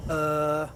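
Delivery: a drawn-out filler uh; hesitation uh. Shown as a demonstration. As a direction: hesitate mid-sentence with an uh. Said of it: "Sometimes we'll go".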